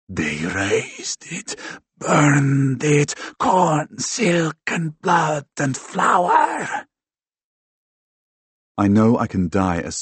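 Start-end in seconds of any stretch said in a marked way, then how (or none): 0.1-6.9 fey growl. A narrator's reading. Ghost-possessed tribesman babbles in his victim's ear, fey growl. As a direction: growl it out eerily, stretched and rising to a shout. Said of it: "his voice a fey growl".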